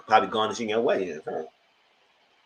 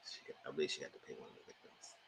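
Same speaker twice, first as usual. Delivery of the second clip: near-silent whisper to yourself; whispered aside; 11 semitones lower, 23 dB quieter, faster than usual. Real, not acted. Unsure of the facts, whispering under her breath.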